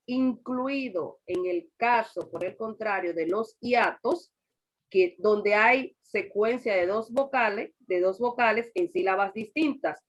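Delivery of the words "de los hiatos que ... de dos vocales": normal talking voice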